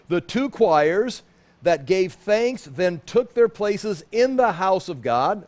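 slow, deliberate Bible reading; scripture reading. Acted. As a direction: read the verse slowly and solemnly. Reads scripture aloud.

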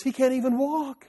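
high-pitched imitation of his wife protesting; wife objecting to the nurse, in a high mock-worried voice; imitating worried wife. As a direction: mimic the wife's alarmed objection, pitched well up. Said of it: "She goes".

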